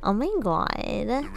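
mocking Valley Girl with stretched vowels; exaggerated Valley Girl disbelief; Valley Girl accent. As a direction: put on a ditzy Valley Girl voice. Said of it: "redeem a Valley Girl accent".

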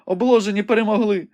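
high, urgent maiden reporting a battle; young woman Rebecca, breathless and excited. A narrator's reading. Rebecca calls the battle out to the wounded Ivanhoe, high and urgent. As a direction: describe the fight in a high, eager voice.

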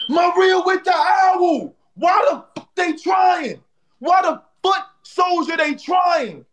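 high, slow, drawn-out hype yell; excited shout. Real, not acted. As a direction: holler it to the whole stream. Excited over the live battle gifts.

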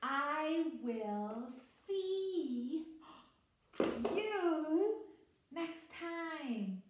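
high, slow, drawn-out goodbye; teacher voice. Acted. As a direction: stretch it out for a little kid. Teacher farewell to young student.

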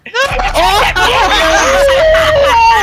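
shrieking laugh at the top of the voice; loud laughing scream. Real, not acted. Big moment after a kill.

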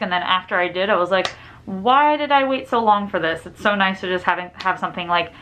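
muffled through cloth mask; speaking through a face mask. Real, not acted. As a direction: mask over mouth and nose.

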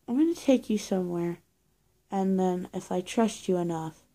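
usual speaking voice

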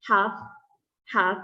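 "Have" said as the contraction I've; teacher models I have shortened, "Have" as one-syllable I've; contracted form. Shown as a demonstration. Teacher showing students the short form of I have, contracted form. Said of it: "I have ย่อเป็นอะไร".

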